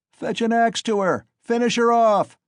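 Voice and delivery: very high, loud savage yell; bloodthirsty onlooker yelling, high and loud; shouted. A narrator's reading. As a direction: scream for the kill. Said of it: "shouted a third".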